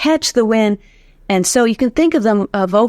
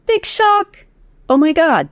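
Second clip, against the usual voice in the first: high, hammed-up gasp of fake amazement; mock surprise; 9 semitones higher than usual. Coach teasing her students with mock amazement, high and hammed up. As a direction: play it like a fake big reveal. Mock shock at a known fact.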